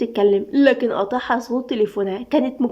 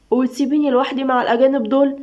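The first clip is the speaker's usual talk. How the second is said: ordinary delivery, nothing marked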